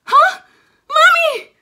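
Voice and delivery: put-on very loud, high startled child; sudden piercing yell for help; panicked cry. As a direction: shriek for mom in alarm. Gabby wakes with gum in hair.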